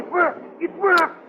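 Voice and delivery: high, rising to a loud shout; triumphant whoop; excited cry. Acted. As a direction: burst out in disbelieving joy. The radium blast succeeds.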